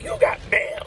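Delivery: high mock announcer chirp on "you got mail"; AOL mail voice. Recalling AOL, imitates the chirpy mail alert. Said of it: "when the A O L got".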